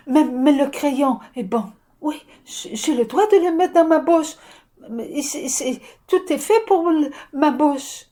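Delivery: put-on a toddler thrilled everything goes in her mouth; delighted child. Toddler telling the session everything is for her mouth, gleeful.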